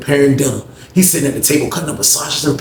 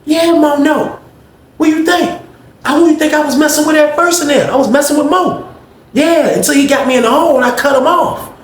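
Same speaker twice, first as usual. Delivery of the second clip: much higher, louder, sassy confession; Greg's high gossiping voice; 13 semitones higher, 4 dB louder than usual. Retold or imitated. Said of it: "He was like".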